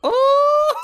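a high, loud sudden Oh!; surprised yelp. Real, not acted. Realizing something mid-chat, high loud surprised yelp. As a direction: jolt upward as it dawns on you.

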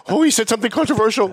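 high-pitched mock outrage; mock-scandalized politician. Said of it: "he'd be shrinking".

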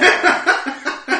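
loud, high peal of laughter; helpless real burst of laughter; cracking up. Real, not acted. Cracks up at own mistake.

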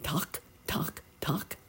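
soft, short voiceless tocks; egg tapping. A narrator's reading. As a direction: tap out tiny knocks with the voice. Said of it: "went one of the eggs".

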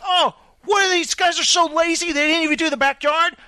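high-pitched imitation of exasperated griping; mock complaining. Boss outside griping about lazy workers, mock complaining. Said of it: "he's complaining".

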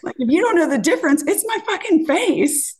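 high, pointed, indignant; indignant retort. Retold or imitated. Answering the man's crude joke, high indignant retort. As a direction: spell it out high and furious.

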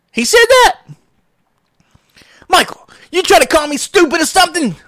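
high and loud, offended squeal; mock indignant coworker. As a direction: play her outraged and disbelieving.

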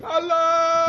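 very high-pitched, drawn-out cartoon wail; mock battle cry. Podcaster mimicking the film's bungling insurgents, mock high-pitched cry.

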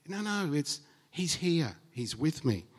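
ordinary talk, nothing marked

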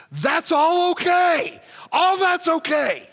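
emphatic preaching. Real, not acted. Preacher declaring it acceptable.

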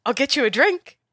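high, quick and bright, barely containing excitement; a little server trying to sound professional; eager young girl. Acted. Girl server offers the heroes a seat, eager voice. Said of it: "she looks at you and says".

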